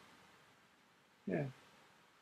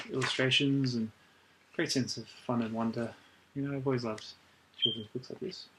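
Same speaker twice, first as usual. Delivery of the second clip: ordinary talk, nothing marked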